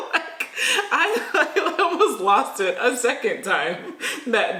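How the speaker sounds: slow, giggling through the words; fighting back a laugh; half laughing while telling it. Real, not acted. Recalling nearly losing it laughing.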